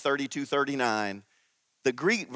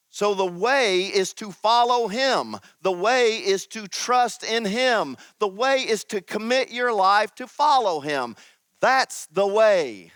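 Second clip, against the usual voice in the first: preaching emphasis; 5 semitones higher than usual. Acted.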